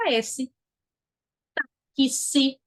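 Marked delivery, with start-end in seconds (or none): 1.5-2.6 TÁXI split slowly as "tá-ki-si"; sounding out the X. Shown as a demonstration. Teacher showing students the two sounds in TÁXI, sounded out. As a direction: say TÁXI with the X as clear K plus S. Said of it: "tem um som de K e de S".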